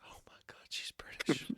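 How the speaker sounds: real whispered aside; shocked whisper. Real, not acted. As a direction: breathe it out in disbelief. Player reacts in shocked aside.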